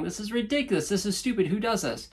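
eye-rolling scorn. Retold or imitated. Teacher mocking the training he first heard, scornful. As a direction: scoff at it like a skeptic. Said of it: "I was rolling my eyes going".

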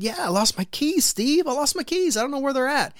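high pleading whine, mock helpless; sheepish plea. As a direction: whine it up high like a lost kid. Retelling own plea to neighbour Steve.

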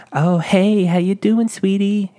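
high, loud mock sweet-talk; flirty customer. Imitating flirty greeting he never gets.

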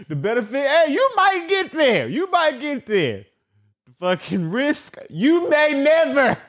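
high, mock sage elder advising; hammed-up life advice; mock motivational speech. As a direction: preach it like a dramatic old mentor. Mock preacher on chasing dreams.

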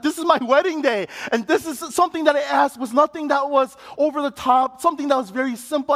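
venting at the church, voice up high; angry. Retold or imitated. Groom upset about his wedding day, angry. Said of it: "And I was so angry".

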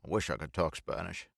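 plain reading voice

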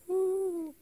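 high excited whoop; excited cheer. Real, not acted. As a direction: cheer like you got in.

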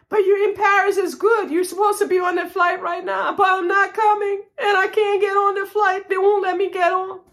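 high-pitched wailing through mock tears; hammed-up tearful cry down the phone; imitated sobbing. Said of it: "I started sobbing".

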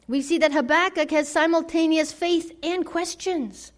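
usual speaking voice

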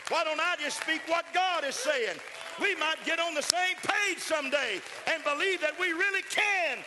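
loud, high, rising preacher's cry; preaching at full force. Real, not acted. Preacher crying out to the congregation at full force. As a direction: build to a ringing climax.

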